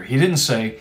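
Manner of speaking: usual speaking voice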